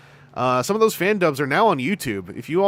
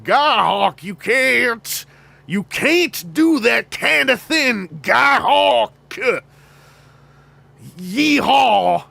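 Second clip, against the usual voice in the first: high, loud Texan cowboy voice, slow and hammed-up; 9 semitones higher, 7 dB louder, much slower than usual. Shown as a demonstration. Voice actor demoing his Texan cowboy character, loud hammed-up Texan accent. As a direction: ham up a cowboy drawl and holler. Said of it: "do the voice. It was, I, Texan".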